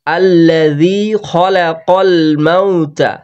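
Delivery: slow, drawn-out Arabic recitation; Quran recitation. Shown as a demonstration. Quran teacher modelling the verse for learners, slow measured recitation. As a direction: recite it slowly, holding the long vowels. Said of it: "এখান থেকে আমরা পড়লাম".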